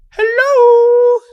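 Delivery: high falsetto hello; weird soft falsetto. Acted. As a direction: float a silly falsetto. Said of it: "in his weird soft falsetto".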